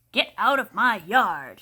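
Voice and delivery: loud slow shout; shouting at the pig. A narrator's reading. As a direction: chase the pig off. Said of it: "she shouted at Mercy".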